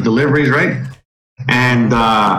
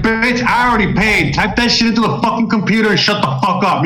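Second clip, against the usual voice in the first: higher-pitched, exasperated mock outburst; mock angry retort; 6 semitones higher than usual. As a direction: imitate telling her off, without shouting. Acted angry retort to cashier.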